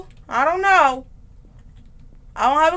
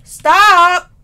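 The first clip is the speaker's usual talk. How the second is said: scared yell; 4 semitones higher, 9 dB louder than usual. Real, not acted. Scared player yelling at game.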